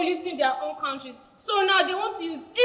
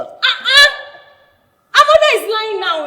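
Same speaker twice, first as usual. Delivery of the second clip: loud, offended uh-uh; indignant outburst; 3 semitones higher, 8 dB louder than usual. Acted. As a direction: push back hard at the insult. Offended by a jab at mother.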